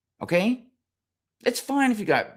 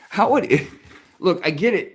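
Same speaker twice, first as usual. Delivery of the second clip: ordinary talk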